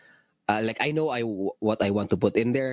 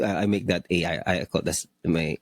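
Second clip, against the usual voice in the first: ordinary delivery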